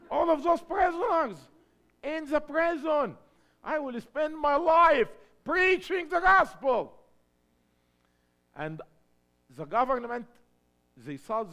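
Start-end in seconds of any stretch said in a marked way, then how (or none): 0.1-6.9 higher and louder, laughing with joy; bursting with eagerness; real joy retelling his prison mission; excited. Real, not acted. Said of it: "I was excited".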